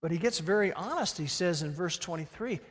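ordinary talk

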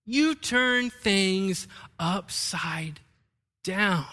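very slow, drawn out, stressed; slow emphasis. Shown as a demonstration. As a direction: stretch it out slowly and solemnly. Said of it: "repeating emphasised verse".

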